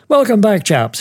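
high-pitched British officer's greeting; British military attaché, voiced high. Attaché greets them in retelling.

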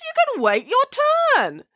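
very high, insistent order; indignant scolding. Acted. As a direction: put the queue-jumper firmly in place. Nanny quarrels with the robber.

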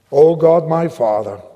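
slow, solemn prayer with long pauses; earnest prayer. Acted. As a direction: pray it aloud, phrase by phrase for the listeners to repeat. Leads prayer phrase by phrase.

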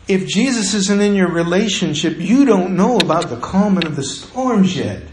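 ordinary delivery

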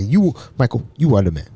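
low-pitched African man, imitated; African accent. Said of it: "That's what he was saying".

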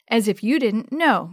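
ordinary delivery, nothing marked

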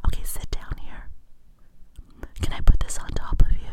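whispering salon greeter; hushed invitation to sit down; whispered asmr role play. Shown as a demonstration. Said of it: "it'll go something like this".

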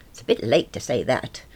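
usual speaking voice

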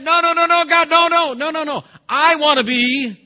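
very high, very loud desperate begging; frantic pleading. Acted. Jeremiah begging God, shrill high and very loud. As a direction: plead like your life depends on it. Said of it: "He could have said".

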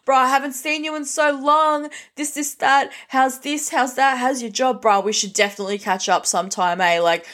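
high, bright mock-buddy greeting; two-faced friend fakes delight at the pub mate; fake friendly bro. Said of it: "when they see them, like".

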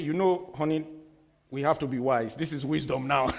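low, soft mock persuasion; coaxing husband. Husband persuading wife to be wise, quiet mock coaxing.

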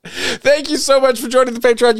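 high-pitched, laughing gratitude; helpless amusement in the voice; laughing thanks. Real, not acted. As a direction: say thanks while still cracking up. Still laughing after the joke.

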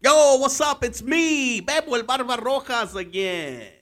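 loud, high, pumped-up hello; hyped greeting. Real, not acted. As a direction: fire up the crowd.

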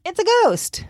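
high-pitched imitated panic; playfully alarmed, voice jumping high; mock freak-out. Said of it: "And they freak out".

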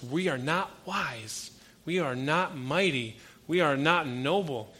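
slow, each line hit on WE; preached emphasis. Real, not acted. Pastor declaring to the congregation what we are not, slow and emphatic. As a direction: hammer each line home slowly.

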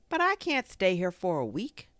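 high, loud objection; dismayed protest. A narrator's reading. Pooh told he is stuck a week, dismayed protest.

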